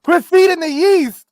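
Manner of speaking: high, loud shouted line; shouted quote. Retold or imitated. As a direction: yell it out as a command. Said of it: "It's a great line".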